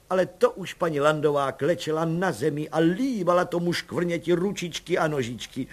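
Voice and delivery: ordinary delivery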